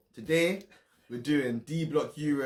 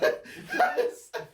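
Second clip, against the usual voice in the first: high squealing laugh; 3 dB louder than usual. Real, not acted. Cracking up at bad accent.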